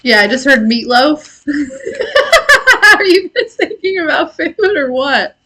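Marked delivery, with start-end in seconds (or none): 1.5-2.9 high burst of laughter. Real, not acted. Laughing at food-obsessed viewers. 3.0-5.4 asked through real laughter, voice lifted high; teasing the viewers as laughter breaks in; laughing question. Real, not acted. Streamer teasing chat about food, laughing while asking.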